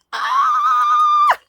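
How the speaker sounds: very high, loud squeal of joy; excited scream. Real, not acted. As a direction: shriek like a delighted fan. Sees the Evil Queen piece.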